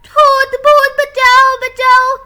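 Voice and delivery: high, loud screams for help; panicked cry for help. Acted. Child screaming for help as the ghost appears. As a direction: shriek for rescue from the ghost.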